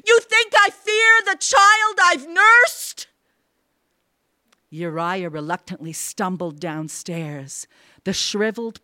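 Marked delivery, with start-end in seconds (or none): 0.1-3.1 very high, loud, outraged question; shrieking defiance. A narrator's reading. Mother defies her husband's fear, shrieking. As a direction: shriek it in indignant grief. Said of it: "shrieked Erda".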